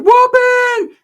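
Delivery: very high, loud excited squeal; gleeful battle cry of an eager sidekick; Ricky. Acted. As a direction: shout it in piercing falsetto.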